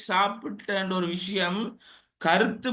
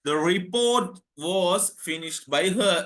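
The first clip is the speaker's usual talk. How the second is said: very slow, word-by-word example; deliberate dictation pace; slow model sentence; much slower than usual. Shown as a demonstration. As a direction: stretch each word out for the class. Teacher repeats passive answer slowly.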